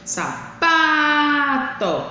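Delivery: "sapato" with a long, high PA; slow saPAAA-to stress demo; stressed syllable drawn out. Shown as a demonstration. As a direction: linger on the middle syllable of "sapato". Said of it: "demorar mais com ela na boca".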